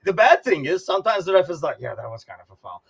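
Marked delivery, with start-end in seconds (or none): 1.8-2.8 mock mumbling ref. Ref grudgingly admits foul.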